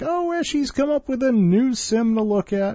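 high, put-on mocking voice; a high, mocking imitation of her excitement; mock quote. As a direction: toss the line off in a high sing-song mock voice. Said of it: "and it's like".

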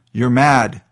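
loud shouted protest. A narrator's reading. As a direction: throw it out at the captain. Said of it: "the second mate shouted".

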